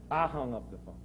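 ordinary talk

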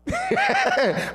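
very high-pitched burst of laughter; mock laugh. Preacher acting out putting doubters out, high laugh. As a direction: let out a high, playful laugh.

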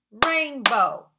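"Rainbow" in two punched beats; "Rainbow" loudly divided in two; syllable by syllable. Shown as a demonstration. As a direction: count out "Rainbow" with a clap per syllable. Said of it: "It has how many?".

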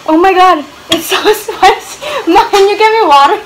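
loud, high, breaking into laughter; laughing spicy panic. Real, not acted. Vlogger begging mother for water, laughing panic. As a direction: cry out for relief from the burn, half laughing. Mouth burning, calls for water.

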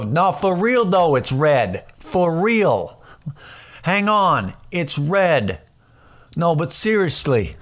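high, pleading cop insisting; hammed-up exasperated cop impression; mock traffic cop. Cop pleading with drivers at a working traffic light, insistent. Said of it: "put cops to go".

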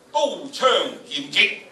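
slow booming recital of battle words; loud battle recital. Shown as a demonstration. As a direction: declaim it like clashing armies. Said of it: "大概去到七度到啦".

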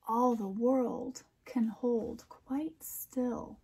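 slow, lilting picture-book reading; poem read aloud. A narrator's reading. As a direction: read it like a bedtime rhyme for kids. Closing poem verses.

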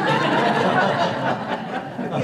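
a real burst of laughter, high and breathy. Real, not acted. Father telling the story of his son bursts out laughing.

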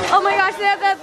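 ordinary talk, nothing marked

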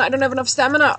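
high, loud repeated panic; alarmed repeat of the stamina cry; panicked cry. Real, not acted. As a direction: yell it again in alarm.

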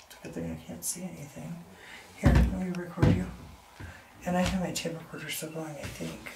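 near whisper, very soft; hushed. Real, not acted. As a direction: keep it barely audible in the dark. Recording in the dark.

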